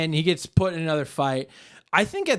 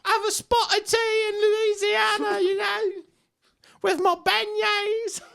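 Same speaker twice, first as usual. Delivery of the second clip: much higher put-on British accent; mock British accent. British fighter talking about Louisiana, put-on British accent. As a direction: drawl it like a Brit abroad.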